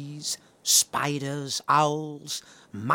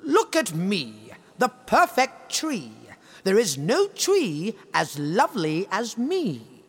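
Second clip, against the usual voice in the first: higher, proud, showing-off voice; boastful tree; 6 semitones higher than usual. Acted. The tree boasting to the forest, higher and proud. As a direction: preen like a vain tree. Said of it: "All day long it would say".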